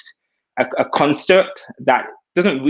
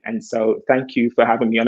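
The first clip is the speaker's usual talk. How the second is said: usual speaking voice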